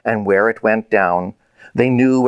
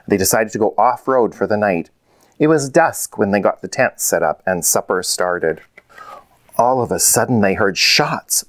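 ordinary delivery

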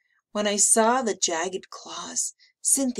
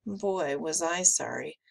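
ordinary delivery, nothing marked

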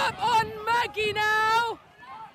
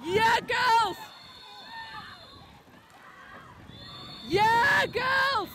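shouting; 3 semitones higher, slower than usual. Real, not acted. [name] calling the race finish.

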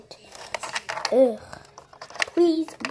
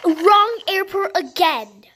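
exasperated; 4 semitones higher, 6 dB louder, slower than usual. Pretend. Passenger fed up with driver.

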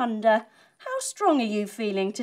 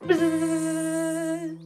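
buzzing bee; 4 semitones higher than usual. Acted. Bee dives into flower.

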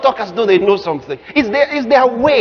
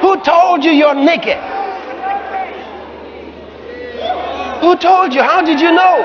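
raised, insistent demand; God questioning; 7 semitones higher than usual. Acted. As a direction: press the question, high and forceful. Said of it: "God said".

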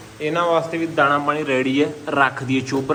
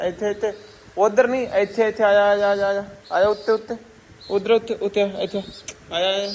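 calling a pet; 5 semitones higher than usual. Real, not acted. Coaxing the bird to come.

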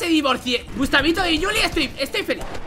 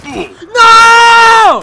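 loud no; 6 semitones higher, 15 dB louder than usual. Real, not acted. Denying divorce loudly.

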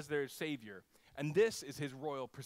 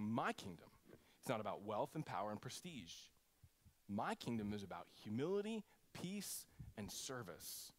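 gentle declaration; 7 semitones lower, 8 dB quieter than usual. Pretend. Jesus's kingdom voiced through the preacher.

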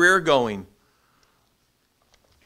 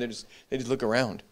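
ordinary talk, nothing marked

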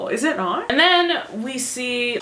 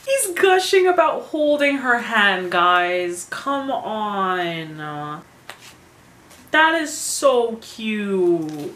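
drawn-out, melting fangirl delight; swooning gush; much slower than usual. Real, not acted. Fan gushing to viewers over hand-holding, drawn-out swooning.

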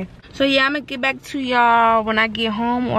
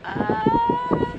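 much higher, squealing giggle; giddy squeals; 13 semitones higher than usual. Real, not acted. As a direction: squeal about the dress slit. Flashing the dress slit.

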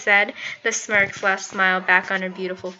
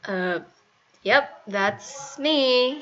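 awkward; 3 semitones higher, much slower than usual. A narrator's reading. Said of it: "he said awkwardly".

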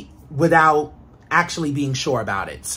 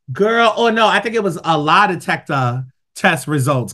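ordinary delivery

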